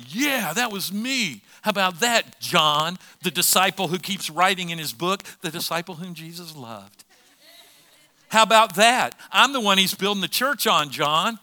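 imitating a cocky show-off; boastful gloating. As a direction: crow over a rival, loud and proud. Peter imagined boasting to John.

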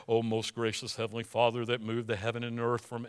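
preacher mocking lofty prayer language; pompous prayer voice. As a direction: parody the over-formal pray-er. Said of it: "when they start to pray".

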